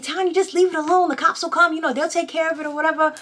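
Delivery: pleading neighbor. Retold or imitated. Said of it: "She was like".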